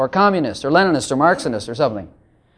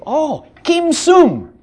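higher, drawn-out Korean-style "Kim Sung"; mock-Korean cry, stretched on "Kim Sung"; 7 semitones higher, much slower than usual. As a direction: exclaim "Kim Sung" slowly in imitated Korean. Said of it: "my Korean way of saying".

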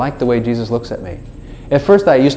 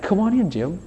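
softer, higher coaxing call; gentle invitation; 6 semitones higher, 6 dB quieter than usual. Acted. God patiently inviting Jim, gentle invitation. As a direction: coax them in softly.

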